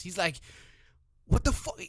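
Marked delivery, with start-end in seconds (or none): 1.2-1.8 high, loud outburst imitating an exasperated father; mock losing it. Retelling Superman fed up with his kids, exasperated outburst. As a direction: blurt it out as a dad at wit's end. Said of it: "Superman lose his shit because his kids".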